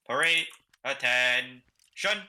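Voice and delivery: long drawn-out parade command, snapped end; drill command. Shown as a demonstration. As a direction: drag out the middle, snap the last word. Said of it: "I'm always going to drag out the middle".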